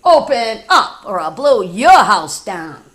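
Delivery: loud, gruff demand at the door; loud wolf threat. Acted. Wolf shouts through the door at the pig, loudly. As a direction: bellow it like a big bad wolf.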